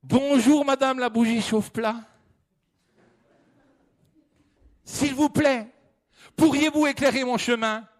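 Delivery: high, eager pleading voice; pleading hiker. Acted. The lost hiker pleading with the flashlight for light. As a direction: beg politely, relieved to find help.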